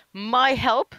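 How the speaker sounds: high, startled query; quietly shaken question. A narrator's reading. Tom answering the detective who needs him, shaken high question. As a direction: pitch it up in shaken disbelief. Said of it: "Tom said quietly and shakingly".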